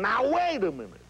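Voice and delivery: exasperated comic protest; high, throaty Jack Benny complaint. Shown as a demonstration. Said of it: "up in the back of the throat where he's swallowing it".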